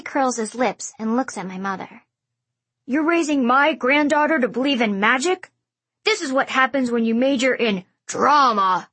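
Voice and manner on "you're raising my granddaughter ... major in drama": disdainful. A narrator's reading. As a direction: sneer at the word drama. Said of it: "He says drama like it's a bad word".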